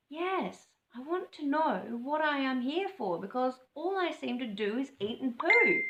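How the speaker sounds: Cobber blurting. Acted.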